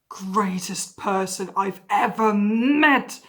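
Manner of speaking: loud, slow, drawn-out declaration; idolising outburst. Acted. Idolising their favorite person, loudly overawed. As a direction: burst out, stretching every word loudly. Acted idolising burst after whisper.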